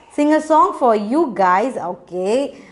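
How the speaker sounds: ordinary talk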